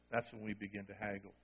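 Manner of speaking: hushed, reflective echo; quiet echo. Shown as a demonstration. Soft repeat for emphasis.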